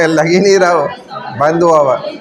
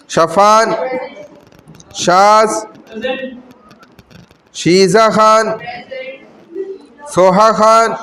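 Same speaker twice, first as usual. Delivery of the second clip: roll call; 3 semitones higher, slower than usual. Real, not acted. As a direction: call each name clearly, sustaining the last syllable. Calls the attendance roll. (हाज़िरी लेते हुए नाम पुकारना)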